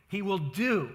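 "do" stretched with a firm fall; stress on DO. Shown as a demonstration. Preacher repeating the Greek wording for emphasis, stress on "do". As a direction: lean hard on the word "do". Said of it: "wants to emphasize the doing".

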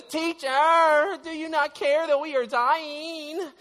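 put-on high, loud pleading wail like a scared child; whiny little boy cry. Disciple crying out to sleeping Jesus, high pleading wail. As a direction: beg like a frightened little boy. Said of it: "kinda crying like a little boy does".

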